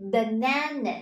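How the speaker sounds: banana with a long stressed middle "na"; second-syllable stress. Shown as a demonstration. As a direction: hit the middle of banana, weak short ends. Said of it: "Âm tiết thứ hai đúng không nào?". (banana nhấn âm tiết thứ hai)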